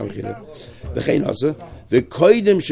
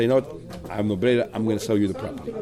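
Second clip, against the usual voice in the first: low and softer, as if into his ear; mock whisper; 5 semitones lower, 7 dB quieter than usual. Seller murmurs his offer privately into lender's ear, hushed. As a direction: lean in and murmur the secret offer. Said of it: "he's gonna whisper in my ear".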